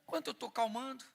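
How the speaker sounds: ordinary talk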